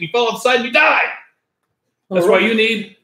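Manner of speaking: rising hard-sell excitement; excited pitch. Acted.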